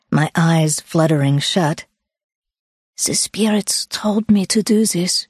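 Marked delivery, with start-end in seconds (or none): 3.0-5.3 put-on higher Swiss-accented earnest confiding; Susanna, Swiss accent. Swiss woman confiding while massaging hiker's feet, Swiss accent. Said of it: "I am from Switzerland".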